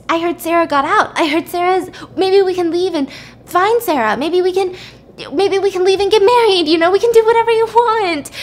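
desperate bargaining. Retold or imitated. Trapped woman talking her captor into leaving, desperate bargaining. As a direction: imitate frantic persuasion, pitch rising steadily.

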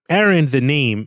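normal talking voice

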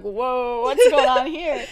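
loud, startled outburst; hammed-up loud surprise; mock alarm. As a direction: react as friends walking into chaos. Friends arrive to a shocking scene.

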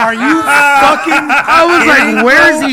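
loud, high, drawn-out incredulity; laughing disbelief. Real, not acted. Co-host reacting to a loaded question, loud disbelief. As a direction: protest loudly through laughter. Mock outrage at host's question.

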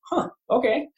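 high, bemused "huh, okay"; lightly acted, amused acceptance; amused surprise. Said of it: "he had a good laugh about it".

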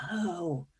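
very soft, hushed "oh"; retold awe, almost under the breath; stunned realization. Stunned by what the ghost said.

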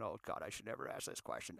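low unvoiced eye-roll mutter; exasperated mutter. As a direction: groan it under your breath. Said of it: "rolling your eyes going".

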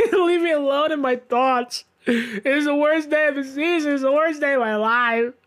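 mock tragic lament; retold melodrama. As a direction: overplay the heartbroken fan. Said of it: "I go".

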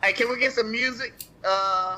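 ordinary delivery

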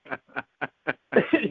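real laugh at own story. Real, not acted. Guest cracking up over the dream, laughing.

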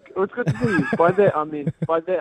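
laughing through the words, pitch high; sheepish laugh as he tries to recover. Real, not acted. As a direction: backpedal while giggling. Laughing through awkward backpedal.

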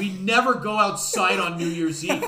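laughing mock complaint. Friend's confused complaint, retold.

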